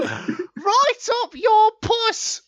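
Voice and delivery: sing-song like a cheeky cartoon sweep; high squeaky randy chimney sweep. Finishing sweep bit.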